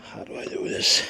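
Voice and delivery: straining under a heavy lift; straining grunt. Real, not acted. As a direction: grunt with effort. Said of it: "Stop grunting".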